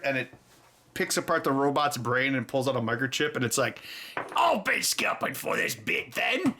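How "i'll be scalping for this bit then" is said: high mock British lizard accent; a squeaky, hammed-up British creature accent. Quoting the lizard from Rick and Morty in a mock British accent. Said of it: "a British lizard".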